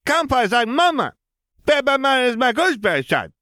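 put-on high, loud little boy; toddler voice. A narrator's reading. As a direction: proclaim like a small child. Ben announces.

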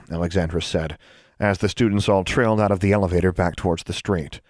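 ordinary delivery

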